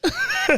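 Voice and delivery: high burst of laughing; squeal of laughter. Real, not acted. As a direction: let out a sudden high laugh.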